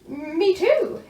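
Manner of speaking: excited knight; knight exclaiming. A narrator's reading. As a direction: jump in eagerly. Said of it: "exclaimed the knight".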